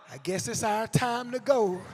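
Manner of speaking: put-on unhurried, drawn-out elderly voice; slow old lady. Old sisters leaving a burning building, slow unbothered voice. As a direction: amble through it with no urgency. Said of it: "They both walk slow".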